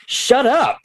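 a high, put-on snap; jokey high-pitched shut up; mock retort. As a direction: fire back in a squeaky mock voice. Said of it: "I'm very good at doing an impression myself as a reaction".

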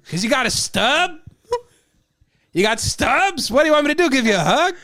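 high-pitched, incredulous mock customer; badly faked voice, rising in pitch and volume; attempted impression. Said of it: "Can't do his voice".

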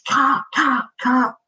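high yelled calls of cut; retold scream. Film director yelling to stop a riot scene, high shout. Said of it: "the guy's screaming".

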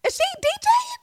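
high squealing excited fan voice. Retold or imitated. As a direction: gasp it out like a thrilled follower. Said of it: "be like".

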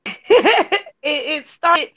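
laughing through the words; giggling as she starts talking. Real, not acted. Laughs while starting her story.

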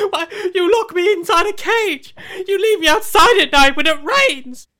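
anguished, loud and high accusations; hammed-up furious betrayed pet; aggrieved talking dog. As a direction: build from hurt to a wailing tirade.

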